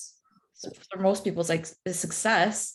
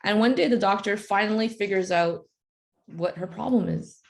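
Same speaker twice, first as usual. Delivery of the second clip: ordinary talk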